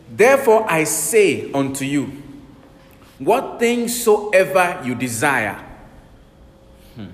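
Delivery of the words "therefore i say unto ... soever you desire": slow, loud, weighty scripture reading; solemn scripture reading. Shown as a demonstration. Preacher reading Jesus' words to the congregation, slow and loud. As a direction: lay out each word of the verse slowly. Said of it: "Verse twenty-four, Mark eleven".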